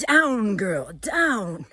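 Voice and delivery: loud, firm mock scolding; mock dog command. Woman scolding her ego, playful loud command. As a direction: order your ego down like a dog.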